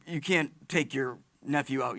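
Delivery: ordinary delivery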